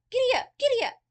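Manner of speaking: loud, high giddy up calls; cried out. A narrator's reading. Little boy urging the horse on, shouting loudly. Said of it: "the little fellow cried out".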